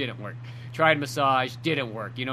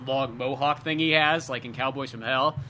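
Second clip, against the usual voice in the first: ordinary talk, nothing marked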